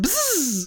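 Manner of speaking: hohes Summen nachgemacht; buzzing noise. Pretend. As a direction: ein hohes Bzzz summen. Geräusch nachgemacht. (hohes Summgeräusch)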